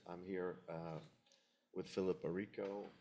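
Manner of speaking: ordinary talk, nothing marked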